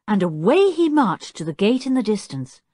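ordinary delivery

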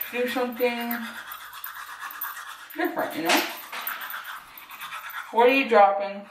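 talking with toothbrush in mouth; while brushing teeth. Real, not acted. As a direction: speak around a toothbrush. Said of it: "I'm brushing my teeth and talking".

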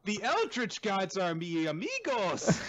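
high, loud, slow proclamation; parody kids' show host. Acted. Dora introducing her eldritch friends, high cartoon voice. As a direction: announce it to toddlers, hammed-up.

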